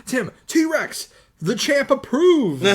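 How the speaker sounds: loud, high imitation of Tim; mock-announcing shout, stretched at the end; speaking in all caps. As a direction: boom it out in all-caps style. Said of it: "he's speaking in all caps".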